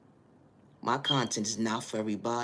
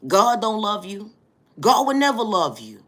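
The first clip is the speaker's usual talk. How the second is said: hurled put-down, yelled high and loud; retold harsh shouting; 9 semitones higher, 8 dB louder than usual. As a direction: shout it like someone berating a child. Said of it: "I used to be told".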